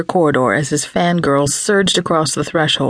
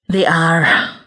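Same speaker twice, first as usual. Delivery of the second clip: grim. Acted. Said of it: "Max said grimly".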